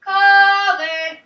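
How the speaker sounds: loud, high, long sung-out name; calling to dinner. Shown as a demonstration. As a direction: holler the name as if calling a child in. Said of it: "Call Colin to dinner".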